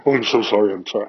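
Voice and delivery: loud, overdone sorry sorry; mock gushing apology. Mocking the ashamed crier he refused to be, loudly overdone. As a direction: blurt apologies in a hammed-up fluster. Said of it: "I didn't, like".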